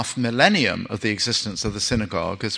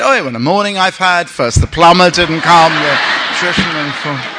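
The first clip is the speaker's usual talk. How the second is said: mock kvetching wife; 6 semitones higher, 7 dB louder than usual. Wife moans about her day.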